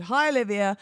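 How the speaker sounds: ordinary talk, nothing marked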